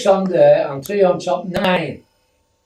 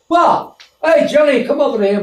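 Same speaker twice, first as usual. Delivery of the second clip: high, loud startled shout across the room; surprised call; 7 semitones higher, 4 dB louder than usual. Retold or imitated. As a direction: call a mate over in disbelief. Policeman calling over colleague.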